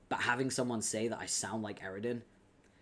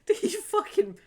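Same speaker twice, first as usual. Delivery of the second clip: sudden high, loud shriek of disbelief; shocked outburst; 9 dB louder than usual. Real, not acted. Streamer shocked by a loud thump in the game, shrieking. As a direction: yelp it out, caught off guard.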